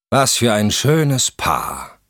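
drawn-out gloating jeer. A narrator's reading. Jealous husband jeers at the couple, gloating. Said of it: "sagte eine hämische Stimme".

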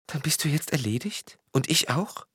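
high-pitched, dismayed question; shaken. A narrator's reading. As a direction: ask it in high-voiced dismay. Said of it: "sagte Frau Degen erschüttert".

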